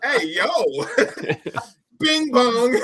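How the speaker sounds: playful mimicked catchphrase, rising in pitch and volume; imitating hyped-up kids. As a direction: call it out like excited kids, laughing. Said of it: "They be like".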